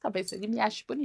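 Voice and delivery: soft, hushed musing to herself; dreamy inner thought. Retold or imitated. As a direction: wonder about him quietly, almost breathless. Rachel pensando sobre o segurança. (Rachel se pergunta baixinho se ele a acha bonita)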